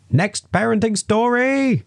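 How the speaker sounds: slow, loud, high mock announcer. Podcast host jokingly announcing the next story, slow loud high announcer voice. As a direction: boom it like a show host introducing a segment.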